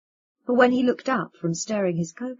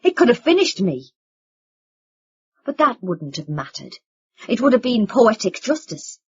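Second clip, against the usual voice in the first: angry accusation; 2 semitones higher, 8 dB louder than usual. Acted. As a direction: spit the accusation at them. Vin accusing family.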